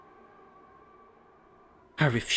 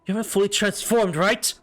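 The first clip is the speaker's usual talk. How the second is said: normal talking voice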